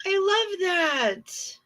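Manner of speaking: high, gushing coo over a cute photo. Real, not acted. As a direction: coo at it like something adorable. Cooing over a tattoo photo.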